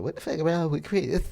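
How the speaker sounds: baffled onlooker. Retold or imitated. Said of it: "looking at me crazy".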